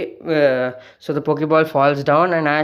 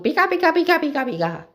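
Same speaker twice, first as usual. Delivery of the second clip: much higher squeaky pika chatter; Pikachu voice; 11 semitones higher, faster than usual. Acted. Pikachu chattering to Ash in its own language, much higher. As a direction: chirp it out like the little mouse. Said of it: "Pikachu starts talking".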